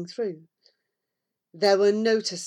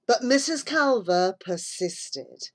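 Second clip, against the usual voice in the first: ordinary delivery, nothing marked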